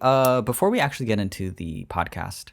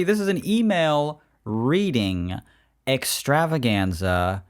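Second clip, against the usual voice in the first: slow, drawn-out show introduction; hyped-up, slow presenter delivery; mock-grand announcer; 4 semitones higher, slower than usual. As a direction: stretch each word like an event announcer.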